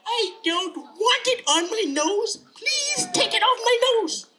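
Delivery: put-on very high, insistent child whine; squeaky boy begging; protesting little boy. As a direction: wail the refusal like a small kid. Tommy protests again.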